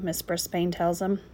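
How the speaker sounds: usual speaking voice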